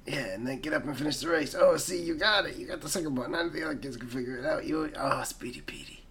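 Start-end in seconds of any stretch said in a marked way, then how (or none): 5.2-6.1 breathy whisper of the nickname. Acted. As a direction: whisper it like a secret.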